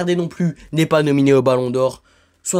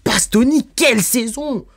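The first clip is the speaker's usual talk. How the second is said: excited praise; 7 semitones higher than usual. Real, not acted. Vlogger raving to viewers about Bastoni's season, excited. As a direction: pitch jumps higher, marvelling at Bastoni.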